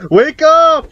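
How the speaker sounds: high, loud desperate call; shouting. Real, not acted. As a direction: yell wake up in panic. Trying to wake his wife.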